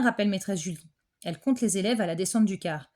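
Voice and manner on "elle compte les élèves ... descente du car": ordinary delivery, nothing marked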